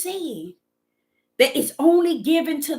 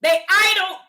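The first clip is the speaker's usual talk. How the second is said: rising impassioned preaching, louder and higher at the end; 3 semitones higher, 3 dB louder than usual. Acted. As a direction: preach it out, building to a cry. Preaching rebuke of idol ways.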